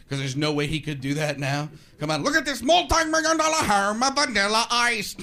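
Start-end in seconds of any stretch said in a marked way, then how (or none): 2.2-5.1 high, loud, hammed-up show-host voice; Robin Leach. TV host presenting a celebrity, hammed-up impression. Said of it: "Robin Leach isn't laughing when he says".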